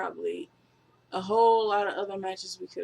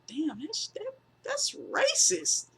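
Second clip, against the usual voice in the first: much higher, squeaky disbelief; shocked; 13 semitones higher than usual. Retold or imitated. Watching the backstage segment, much higher mock-shocked squeak. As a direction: gasp it out in mock outrage. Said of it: "I was sitting there looking at it like".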